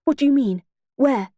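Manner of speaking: slightly urgent questioning; alarmed. A narrator's reading. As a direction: press for answers with mild alarm. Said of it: "I said in alarm".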